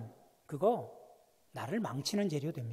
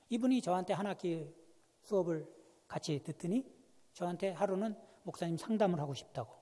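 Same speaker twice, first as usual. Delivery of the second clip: usual speaking voice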